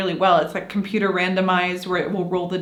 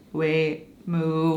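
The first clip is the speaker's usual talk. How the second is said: low, soft, halting words; slow, dull demo; 3 semitones lower, 5 dB quieter than usual. Shown as a demonstration. Showing how sluggish rolling kills fun, dull and halting. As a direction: drop it down and leave dead gaps.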